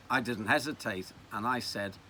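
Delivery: usual speaking voice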